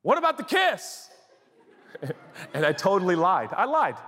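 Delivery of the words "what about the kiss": high, hushed urgent reminder; groom reminding. Retold or imitated. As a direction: groom nudges pastor about the kiss, high and urgent. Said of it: "and he goes".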